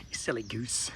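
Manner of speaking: very soft fond murmur; tender tease. Real, not acted. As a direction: tease her gently and lovingly.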